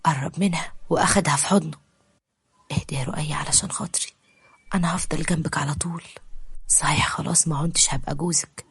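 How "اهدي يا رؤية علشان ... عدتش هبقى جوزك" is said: soft whisper, soothing her through tears; comforting whisper. Acted. Omar calming weeping Roaya before the divorce, whispered. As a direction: hush her gently, whispered. Comforting his crying cousin-wife.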